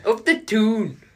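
bad Geordie. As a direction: try a Geordie accent and miss. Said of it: "that is abysmal".

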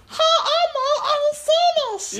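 very high, slow squeaky introduction; Xavier. Acted. Tiny companion introduces himself to the deli lady, high squeak. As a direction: introduce yourself as a tiny, loud critter. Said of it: "he's like".

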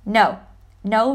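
ordinary delivery

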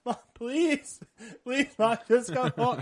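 high, loud mock child's wail; pleading daughter. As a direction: beg Mom like a desperate little girl. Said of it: "the daughter's just like".

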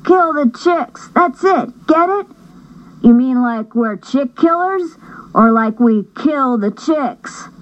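put-on milk the pun like giddy kids; punning boys. Brothers riffing on a pun in the car, high and giddy.

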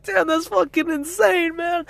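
very high, drawn-out astonishment; amazed reaction. Retold or imitated. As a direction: mimic friends blown away by a story. Acting out friends' amazed reaction.

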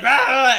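loud mock monster yell; hammed-up alien shriek, loud; monster's loud cry. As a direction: belt out a startled monster cry. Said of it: "the monster's like".

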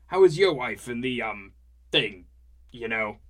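loud, hearty boozy chatter; chatty drunk dad. Acted. Herwig rambling about family to Skivrin, loud and jolly. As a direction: ramble on, half asleep and jolly.

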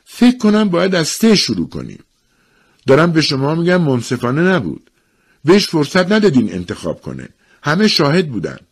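high-pitched, restrained protest; acted strained composure; trying to stay calm. A narrator's reading. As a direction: hold the complaint just in check. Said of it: "با صدایی که سعی داشت آرام باشد".

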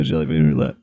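deep, quiet game-show menace; ominous announcer voice. Pretend. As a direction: tease it like a spooky host. Game-show tease of roulette.

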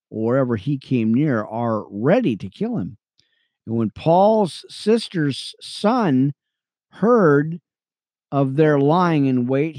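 earnest Bible reading, lifting on names; steady scripture read. Acted. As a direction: read it like a preacher to his radio friends. Reads scripture.

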